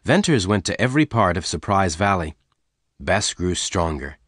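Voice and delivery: plain reading voice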